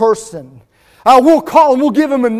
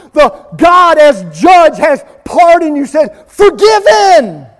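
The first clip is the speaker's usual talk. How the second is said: proclaiming pardon; 4 semitones higher, 5 dB louder than usual. Real, not acted. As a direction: proclaim it with rising force. Preacher rises to proclaim pardon.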